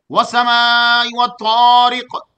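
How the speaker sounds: slow, drawn-out melodic tajweed recitation; Quran recitation. Acted. Preacher begins the day's surah for the congregation, slow melodic recitation. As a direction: stretch every vowel in measured chant.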